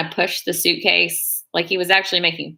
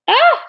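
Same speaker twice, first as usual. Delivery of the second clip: short, high, louder yelp of dismay; startled "ah"; 7 dB louder than usual. Real, not acted. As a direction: cry out as you spot your mistake. Notices the nail is too big.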